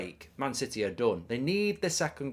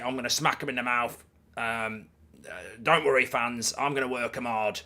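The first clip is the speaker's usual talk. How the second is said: Sean Dyche; 4 dB louder than usual. Retold or imitated. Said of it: "what did he say after the game".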